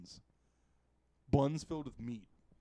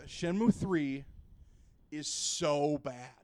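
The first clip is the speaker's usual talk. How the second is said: ordinary delivery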